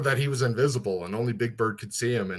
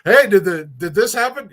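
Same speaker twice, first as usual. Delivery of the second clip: imitating a curt asker, higher pitched; mock quick question; 7 semitones higher, 9 dB louder than usual. Imitating people texting him a blunt question, louder and higher.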